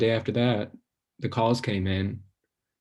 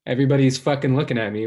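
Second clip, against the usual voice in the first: panicked rush; 2 semitones higher, 4 dB louder, much faster than usual. Acted. Panicked rush of self-consciousness.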